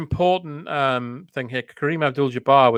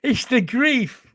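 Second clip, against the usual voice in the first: mock grieving plea; 7 semitones higher, slower than usual.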